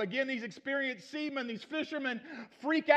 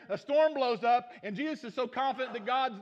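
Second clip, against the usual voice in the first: ordinary talk, nothing marked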